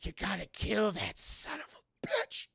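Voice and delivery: slow, strained last-breath plea; dying words. Retold or imitated. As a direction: force it out as if dying. Acts out Dolores's dying words.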